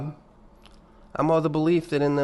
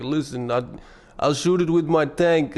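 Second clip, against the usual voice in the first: hammed-up mock Russian accent; bad fake Russian accent. YouTuber offers to shoot a dead horse, bad fake Russian accent. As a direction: attempt a Russian accent and do it badly. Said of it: "I can't fucking do his".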